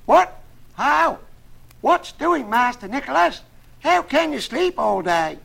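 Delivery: very high, loud frantic shouting; high-pitched hollering to rouse someone; yelling boy. A narrator's reading. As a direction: yell through a door like a panicked servant boy. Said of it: "pounded and yelled as if his wits".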